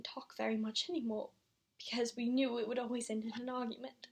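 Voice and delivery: soft and halting, fading out; subdued sorrow. Acted. Mother telling Christopher how the marriage went silent, subdued and lonely. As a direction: confess it quietly, voice thinning at the end.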